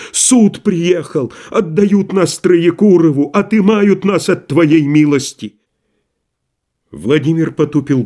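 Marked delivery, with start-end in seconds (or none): 0.1-5.5 slow, high, out of breath; lightly voiced old servant gasping; breathless old person. A narrator's reading. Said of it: "задыхаясь". (задыхаясь, медленно и высоко)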